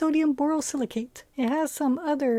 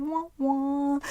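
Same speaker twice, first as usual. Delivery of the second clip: sad trombone. Real, not acted. Loser result, sad trombone.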